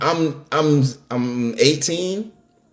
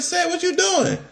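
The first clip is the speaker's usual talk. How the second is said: mimicking the best friend, pitched way up; high girl voice; 3 dB quieter than usual. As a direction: play the friend in a high squeaky voice.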